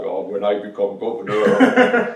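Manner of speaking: loud, bad Austrian accent; Arnold Schwarzenegger accent. Interviewee mimicking the film star he sat beside, bad Austrian accent. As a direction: put on a hammy Austrian movie-star accent. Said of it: "Arnold Schwarzenegger accent".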